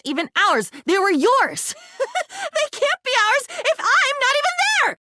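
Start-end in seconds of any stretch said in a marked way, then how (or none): 2.0-2.5 a sharp, pained laugh up high; a high broken laugh; bitter high laugh. Acted. 2.5-5.0 a lament pitched high; bitter lament. Acted. Bitter cry after laugh.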